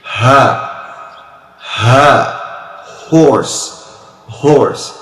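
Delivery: "Ha" and "horse" pushed from the back of the throat; ha from end of throat. Shown as a demonstration. Teacher contrasting throat sounds for students. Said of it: "You make it from here".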